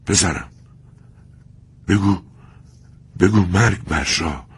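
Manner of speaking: "مرگ بر شاه" in broken, halting words; dying father, gasping between words; broken gasps. Acted. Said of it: "بریده بریده گفت".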